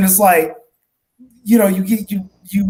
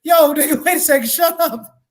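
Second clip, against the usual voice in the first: much higher pitched, laughing mock rant; mock outraged scolding; 9 semitones higher than usual. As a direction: scold him in a high, half-laughing voice. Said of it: "And I'd be like".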